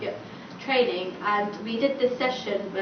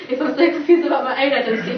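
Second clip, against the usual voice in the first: louder, laughing through the words; laughing self-doubt; 3 semitones higher, 10 dB louder, faster than usual. Retold or imitated. Confused welfare officer doubting herself, laughing retold quote. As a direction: imitate rueful self-questioning through laughter.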